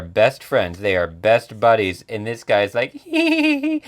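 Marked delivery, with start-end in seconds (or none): 3.0-3.8 high-pitched cartoon hee-hee giggle; mock snickering. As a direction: giggle high and loud like a lurking weirdo. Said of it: "He's just laughing behind a corner".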